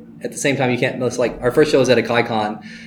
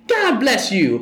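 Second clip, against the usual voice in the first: higher, preachy showman blessing; 10 semitones higher, much slower than usual. Retold or imitated. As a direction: bless him like an old preacher.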